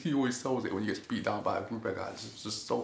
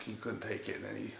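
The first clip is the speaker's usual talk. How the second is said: usual speaking voice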